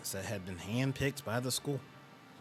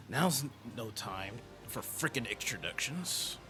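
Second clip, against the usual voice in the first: gruff slow voice; 5 dB quieter, slower than usual. Acted. Gruff character voice.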